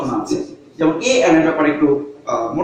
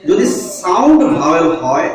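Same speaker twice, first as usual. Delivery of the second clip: normal talking voice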